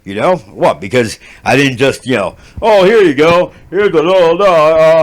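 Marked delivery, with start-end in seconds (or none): 2.6-5.0 mock handover. Acts out carefree handing over.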